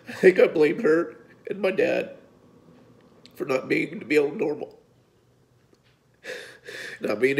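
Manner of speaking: choked-up apology, fighting back tears; emotional, near tears. Real, not acted. Apologizing to his mom and dad, real tears in his voice. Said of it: "I didn't mean to get emotional".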